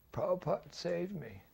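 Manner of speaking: high, simple and grateful; high-pitched heartfelt thanks; Pigpen's grateful voice. Retold or imitated. Pigpen repeats his phrase.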